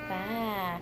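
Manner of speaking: soft bleating baa; sheep bleat. Shown as a demonstration. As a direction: bleat it like a lamb. Teaching child animal sounds.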